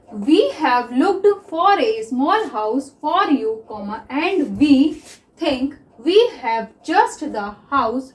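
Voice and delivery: very slow, word by word dictation; slow dictation read. Shown as a demonstration. Teacher dictating slowly to shorthand students. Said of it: "तो सबसे पहले मैं read करती हूँ".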